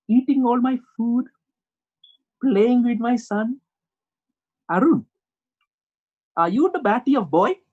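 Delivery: insistent, hammed-up Indian accent. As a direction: interrogate the boy in a mock Indian accent.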